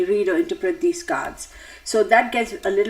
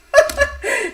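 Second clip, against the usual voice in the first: high laugh breaking out; real laugh; 4 dB louder than usual. Real, not acted. Host forgets the viewer's question and laughs. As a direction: laugh at losing your train of thought.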